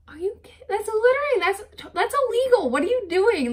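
high, loud outraged squeal; mock outrage. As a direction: squeal at the litterers in disbelief. Outraged at litterers, retold.

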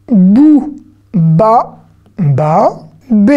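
BOU, BA, BA each read out alone with a long vowel; reading drill. Shown as a demonstration. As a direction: sound out isolated syllables for beginners. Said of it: "l'exercice de la lecture".

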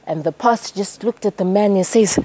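ordinary delivery

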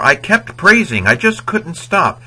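high, loud "I kept praising"; excited. Retold or imitated. Teacher acts out an eager example sentence, high excited gush. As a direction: gush like you can't stop.

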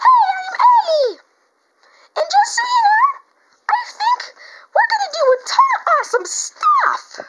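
very high, squealing puppet voice; Eddie the Backpack. Pretend. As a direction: burst out in a squeaky, loud character voice. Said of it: "this is Eddie here".

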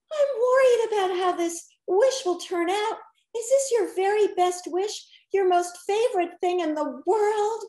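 shy worried question; meek. A narrator's reading. Genie worried about the wish, asking meekly. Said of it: "she asked us meekly".

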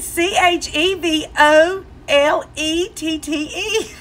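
letters drawn out slowly; slow spelling. Retold or imitated. Husband respells it for wife, slow and deliberate. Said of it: "He said".

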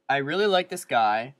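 usual speaking voice

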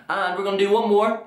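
ordinary talk, nothing marked

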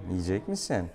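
normal talking voice

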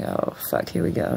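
unvoiced, breathy whisper of dread. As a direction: mutter it under your breath, bracing for trouble. Dreading the cop's arrival.

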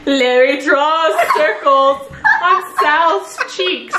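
fanfic read aloud; reading the story aloud, amused; dramatic reading. Acted.

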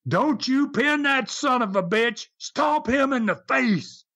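high mock yell, slowing at the end; retold scream. Friend at ringside ordering the wrestler on, retold scream. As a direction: imitate a furious ringside order without full volume. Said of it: "he screams at Dale".

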